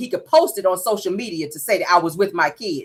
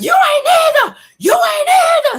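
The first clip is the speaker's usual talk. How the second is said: shrill mock scream, pitched way up; 5 dB louder than usual. Martell screaming at Van at top of his lungs. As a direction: scream it like losing control. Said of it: "still yelling to the top of his lungs".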